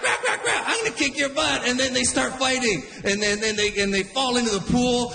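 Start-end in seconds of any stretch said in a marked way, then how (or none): 0.0-0.6 very high imitated quacks; big duck quack. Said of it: "the big one's like". 0.7-1.6 threatening big duck. Retold or imitated. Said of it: "the big one's like".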